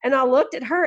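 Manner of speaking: ordinary talk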